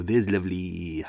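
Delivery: slow, exaggerated Welsh lilt; caricatured Welsh catchphrase; mock Welsh accent. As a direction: draw out the last word in fake Welsh. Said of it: "any Welsh person go".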